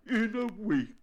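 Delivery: high, loud, alarmed bear; anxious. A narrator's reading. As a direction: echo it in high-pitched worry. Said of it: "said Bear anxiously".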